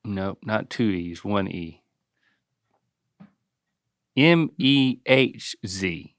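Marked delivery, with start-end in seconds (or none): 4.2-6.1 slow letter-by-letter spelling; spelled out slowly. Shown as a demonstration. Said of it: "Try that".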